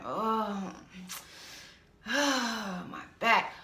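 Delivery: imitating a groaning complainer with a sigh; mock groaning. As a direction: sigh and moan about the back. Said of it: "Groaning all the time".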